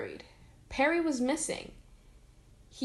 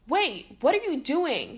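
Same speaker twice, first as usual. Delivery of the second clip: shouting; 5 semitones higher, 5 dB louder than usual. A narrator's reading. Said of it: "he shouted".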